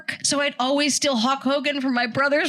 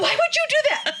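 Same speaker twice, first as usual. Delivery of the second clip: mom's disbelief. Retold or imitated. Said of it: "mom quoted".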